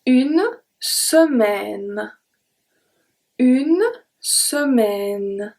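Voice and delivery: Une semaine very slowly, ending on the N; slow, careful une semaine; slow model word. Shown as a demonstration. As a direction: draw out semaine, final E silent. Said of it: "the last letter that I pronounce is the N".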